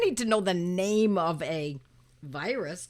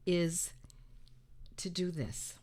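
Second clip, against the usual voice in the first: whispered secret; 6 semitones lower, 9 dB quieter, slower than usual. Acted. Teacher whispers the big secret to students, hushed. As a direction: hush it like a secret. Whispered reveal of best medicine.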